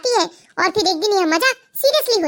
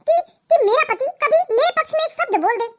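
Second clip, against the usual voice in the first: sarcastic; 5 semitones higher than usual. Acted. ननद ताना मारती.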